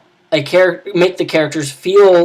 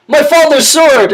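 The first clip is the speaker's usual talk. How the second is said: comic howl of loss, much higher and louder; anguished wail; 11 semitones higher, 6 dB louder than usual. Retold or imitated. Bumbling knight shrieks as his sword flies off the mountain. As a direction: shriek it high and loud in hammed-up despair. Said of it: "he's like".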